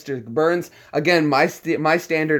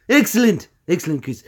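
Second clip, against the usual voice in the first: pitched-up, punched first word, then easing down; Mister Burns; 5 semitones higher, 5 dB louder than usual. Acted. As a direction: say it like an eccentric tycoon villain. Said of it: "Mister Burns is a little more eccentric".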